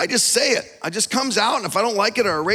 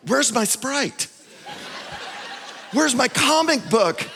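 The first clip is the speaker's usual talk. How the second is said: mock whining patient; 4 semitones higher, slower than usual. Sick husband whining.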